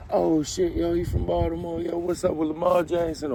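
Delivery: high, excited imitation of a fan; hyped seatmate voice. Seatmate quizzing him about Baltimore, high and excited. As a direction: pitch it up like a fan geeking out.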